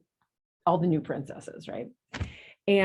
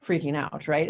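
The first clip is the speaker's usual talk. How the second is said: ordinary talk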